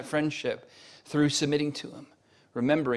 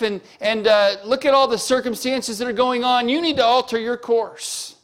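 much higher and louder, demanding God change course; defiant believer; 9 semitones higher, 8 dB louder than usual. Acted. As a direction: insist loudly, as if God must obey you. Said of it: "you can say".

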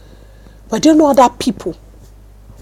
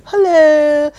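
higher-pitched, drawn-out Canadian hello; put-on Canadian accent; 5 semitones higher than usual. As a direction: imitate a sweet Canadian phone voice. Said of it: "my voice is different".

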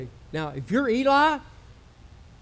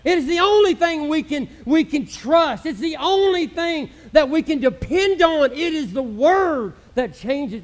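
louder, higher, rolling preacher cadence; full-force preaching; 4 semitones higher, 6 dB louder than usual. Acted. Pastor preaching to the church about the power of the Word, fervently. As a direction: preach it with fire.

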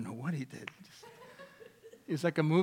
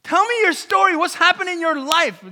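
raised, driving sermon voice; impassioned preaching; 11 semitones higher, 12 dB louder than usual. Real, not acted. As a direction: build from firm statements to a loud, high appeal. Preaching at full force.

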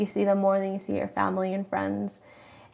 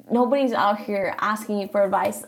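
ordinary delivery

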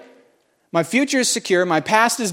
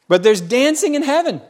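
preached out; 9 semitones higher than usual. Acted. Repeats line as loud proclamation.

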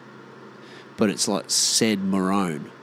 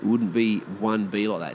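ordinary delivery

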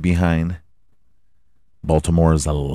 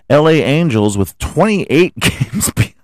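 laughing read; 7 semitones higher, 3 dB louder than usual. Real, not acted. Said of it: "can't laugh too hard".

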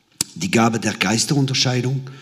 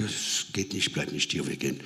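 very soft, low aside; quietly insist, for one listener only; hushed refusal; 8 semitones lower, 12 dB quieter than usual. Retold or imitated. Quiet aside to his wife. (leise, tiefe Weigerung)

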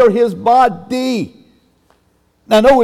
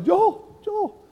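high, very soft calling of a name; voice of the Spirit; 10 semitones higher, 7 dB quieter than usual. Retold or imitated. Spirit of God trying to catch the driver, high soft call. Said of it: "And the Spirit of God says".

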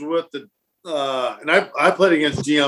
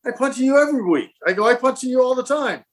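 higher, indignant retort; mock protest; 8 semitones higher than usual. Punter protesting to Dion, mock indignant. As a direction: play it up as hurt innocence.

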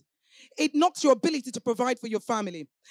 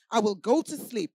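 ordinary delivery

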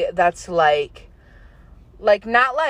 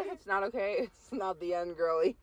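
hushed; 13 dB quieter than usual. Pretend. Dramatic reread, quietly.

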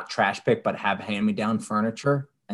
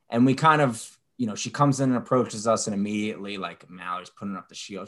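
ordinary talk, nothing marked

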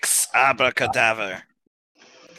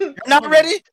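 incredulous shout; 5 dB louder than usual. Acted. Character yells in disbelief.

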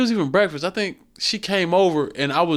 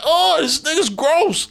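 slow, high, disgusted; mock disgust; 12 semitones higher, 3 dB louder, slower than usual. Son complaining to mom about dad, slow mock disgust. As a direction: drag it out like you're grossed out.

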